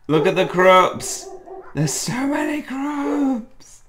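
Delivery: very high, stretched-out delight; ecstatic squealing. Acted. Friend pointing out all the creatures, squealing with joy. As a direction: squeal it, dragging out the last word.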